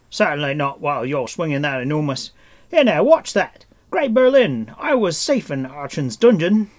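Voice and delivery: high, loud, flustered outcry; alarmed protest. A narrator's reading. Stranger refusing to come out while Taran swings, alarmed and loud. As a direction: yelp in alarm as the blade swings.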